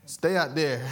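joking. Acted. Punchline with laugh.

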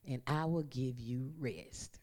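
tender scripture close; soft, tender promise. Acted. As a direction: lay it down gently like a lullaby. Quoting Jesus softly.

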